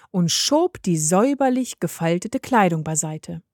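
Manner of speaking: usual speaking voice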